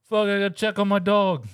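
high, loud worried exclamation; mock alarmed owner. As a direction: blurt it as the alarmed dog owner. Dog owner after the crash.

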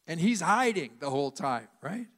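normal talking voice

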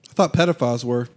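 ordinary delivery

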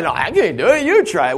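the other disciple refusing in turn; mock flustered reply from a second disciple; second panicky disciple. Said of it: "They were too busy going".

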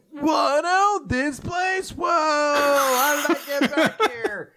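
high, drawn-out cry of wonder; amazed shout. Acted. Asta arriving somewhere foreign, hammed-up amazed shout. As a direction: gawp loudly at the sights, words stretched.

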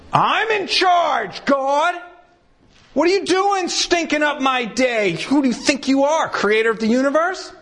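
high, defiant shout at God; indignant shout. Retold or imitated. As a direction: rant like an arrogant creature. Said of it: "as creatures going".

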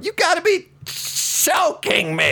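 very high, stretched-out mock squeal; Xavier Woods. Host imitating a costumed wrestler's video, very high drawn-out mock voice. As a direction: shriek the catchphrase slowly, hammed right up. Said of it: "a video of him just going".

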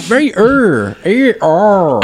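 mock R-heavy er; exaggerated R's. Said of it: "I think you exaggerated".

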